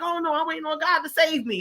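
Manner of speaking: stubborn refusal. Retold or imitated. Drowning man refuses.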